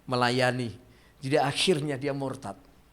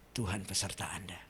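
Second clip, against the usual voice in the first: a soft unvoiced whisper of comfort; whispered blessing; 7 semitones lower, 9 dB quieter than usual. Preacher whispers a blessing to the congregation, hushed. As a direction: breathe the blessing to them.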